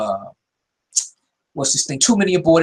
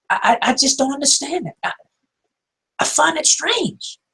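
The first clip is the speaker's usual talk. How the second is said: baffled; 12 semitones higher than usual. Real, not acted. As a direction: let the pitch climb in honest bewilderment. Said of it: "I'm just baffled".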